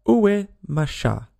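French phrase. A narrator's reading. French lesson sentence.

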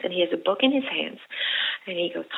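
usual speaking voice

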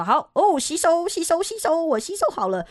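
high, excited mock voice repeating 吸收; mock eager learner. Learner mocked for just reading the Chinese meaning, high mock voice. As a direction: play the naive learner who thinks they absorbed it. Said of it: "你就说".

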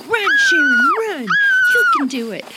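high, loud cheer of run. Retold or imitated. Narrator cheering Sharon on to the tree. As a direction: urge her on with a shout. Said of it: "I was like".

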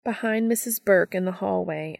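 usual speaking voice

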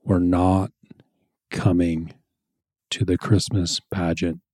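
slow, hushed, low and calm; calm, firm, almost whispered refusal. Shown as a demonstration. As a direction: state the boundary in a slow near-whisper. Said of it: "slow and kind of calm".

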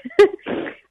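high giggle burst; high laugh. Real, not acted. Telling the dream to her listener, high giggling laugh.

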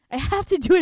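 can't help saying it again; compulsive burst. Shown as a demonstration. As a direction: blurt it out like a tic. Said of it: "It's like a tick".

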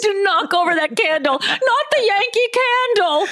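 high panicked wail; mock panic. Panicking over scented candles setting fire, high mock horror. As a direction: shriek in hammed-up horror.

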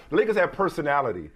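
usual speaking voice

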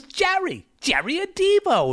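high squeaky Three Stooges voice; high-pitched cartoonish stooge call; Curly impression. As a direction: shriek the name like Curly. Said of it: "he starts doing Curly from the Three Stooges".

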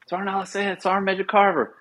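high-pitched official phone greeting; put-on military phone voice; imitating sergeant major. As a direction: pitch it well up, announcing rank and name.

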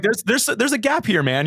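host voiced high to tease the guest; mock puzzled host. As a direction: playfully mimic the host catching a gap. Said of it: "you're like".

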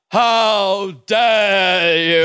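very slow, drawn-out put-on voice; rhinoceros cartoon lady. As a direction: imitate a cartoon character, stretching every word. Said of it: "she would always say".

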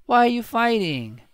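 put-on high-pitched little kid voice; baby voice. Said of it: "don't do a baby voice".

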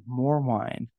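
ordinary talk, nothing marked

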